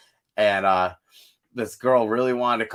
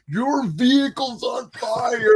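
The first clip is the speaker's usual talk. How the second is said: high, slow yell across lanes; shouting warning; 13 semitones higher, 5 dB louder, slower than usual. Retold or imitated. Band yelling to a driver whose car burns, high slow shout. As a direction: holler to the driver beside you. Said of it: "we're like".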